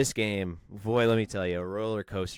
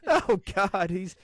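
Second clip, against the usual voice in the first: laughing; 13 semitones higher, 3 dB louder, slower than usual. Real, not acted. Laughing disbelief at friend.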